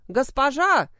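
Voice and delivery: loud call across the yard. A narrator's reading. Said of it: "окликнул даму".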